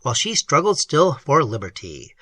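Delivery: usual speaking voice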